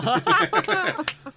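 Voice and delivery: high, loud burst of laughter; laughing. Real, not acted. Streamer cracking up after parodying a rambler, high laughing.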